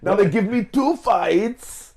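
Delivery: goof a fighter's voice in a bad Russian-French accent; bad mixed Russian French accent. Cohost mocks a fighter in a bad Russian-French accent. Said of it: "cross between a bad Russian, French".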